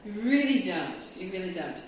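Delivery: ordinary talk, nothing marked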